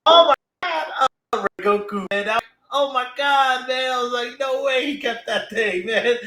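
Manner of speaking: high-pitched, thrilled disbelief; amazed fan reaction. Retold or imitated. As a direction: relive the shock of a big reveal. Reliving shock at the reveal.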